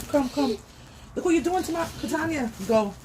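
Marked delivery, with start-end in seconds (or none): none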